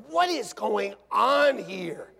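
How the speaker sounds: slow, high, emphatic disbelief; acted bewilderment. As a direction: punch out each word, baffled. Acting out his bewilderment.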